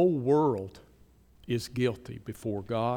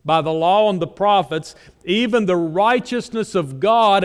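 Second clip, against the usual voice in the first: loud, raised preaching voice; forceful preaching; 6 semitones higher, 11 dB louder than usual. Acted. Preacher booming Romans to the congregation, loud and raised. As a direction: drive the verses home from the pulpit.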